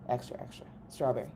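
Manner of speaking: quick whispered list; whispering. Pretend. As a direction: hurry it out in a whisper. Piling up extra strawberry.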